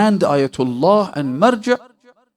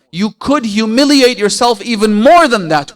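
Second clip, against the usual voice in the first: loud, raised-pitch reproach; acted rebuke; 7 semitones higher, 7 dB louder than usual. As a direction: voice the Imam's forceful scolding.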